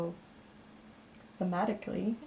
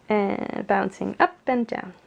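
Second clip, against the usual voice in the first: lively, louder picture-book reading; story narration; 9 dB louder than usual. A narrator's reading. Reading a children's book to viewers, lively storytelling. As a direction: read it out to a child with big emphasis.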